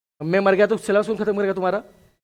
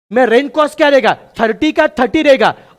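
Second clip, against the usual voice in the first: emphatic teaching; 5 semitones higher, 7 dB louder than usual. Real, not acted. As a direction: drive the point home to the class. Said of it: "teacher repeats point".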